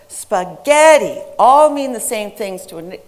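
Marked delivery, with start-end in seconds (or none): 0.1-1.2 SPAGHETTI as spuh-GET-ee, middle loud and long; center-syllable stress. Shown as a demonstration. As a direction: correct "spaghetti" with a punched second syllable. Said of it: "the center syllable was pronounced".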